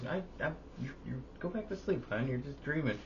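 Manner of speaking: a high mock of dad humouring her. Dad humouring the dreaming mom, in a high mock voice.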